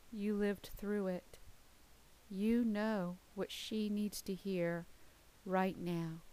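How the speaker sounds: usual speaking voice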